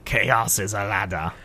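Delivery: low, quiet mock-Littlefinger; Littlefinger impression. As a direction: purr it like a smug schemer. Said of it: "your Littlefinger impression".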